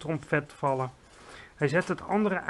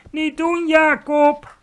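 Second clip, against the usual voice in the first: high, loud, desperate cry; screech; 11 semitones higher, 11 dB louder, slower than usual. A narrator's reading. Giant writhing in his nightmare begs Jacob, high loud screech. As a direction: screech it to stop your attacker. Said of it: "krijste hij".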